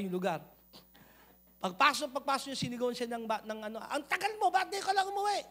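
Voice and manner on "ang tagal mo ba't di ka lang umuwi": high mock scolding yell; a hint of a shouting mother; retold mother yelling. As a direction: imitate the angry mother scolding her child. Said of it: "yung pagsigaw nung nanay niya".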